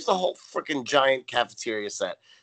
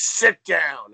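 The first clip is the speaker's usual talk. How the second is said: loud, forceful barked order; mock bark; 3 semitones higher, 7 dB louder than usual. Natalie storms in on Missus Garrett, forceful mock bark. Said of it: "storms in and barks".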